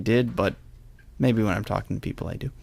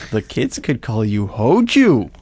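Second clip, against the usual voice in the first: hammed-up punchline; 4 dB louder, slower than usual. Host quoting a joke about changing your name, hammed-up punchline. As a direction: draw it out, then belt the silly nickname.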